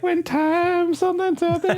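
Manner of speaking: hammed-up goofy superhero voice; a jokey squeaky send-up of a hero's line; mock Quicksilver.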